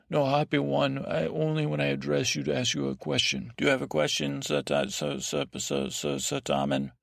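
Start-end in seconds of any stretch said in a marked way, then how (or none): 4.4-6.9 low, deep mad scientist voice; deep doctor voice. Pretend. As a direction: drop low like a gruff old doctor. Doctor Panic character voice.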